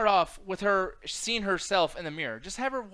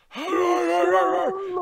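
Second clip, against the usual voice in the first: raspy laugh demo; 11 semitones higher, 6 dB louder than usual. Shown as a demonstration. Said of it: "You'll talk like this".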